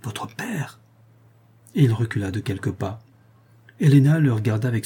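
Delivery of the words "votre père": very soft, choked echo rising high at the end; stifled voice. A narrator's reading. Monk stepping back from the girl, stifled voice. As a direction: barely get the question out. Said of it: "dit Schedoni d'une voix étouffée".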